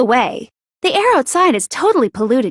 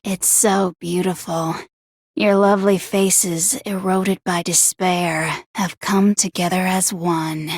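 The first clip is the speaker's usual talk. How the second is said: low, softer, savouring every word; low hushed rapture; 9 semitones lower, 5 dB quieter than usual. Acted. Villain gazing at the students' despairing faces, low and hushed. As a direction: murmur it like admiring art.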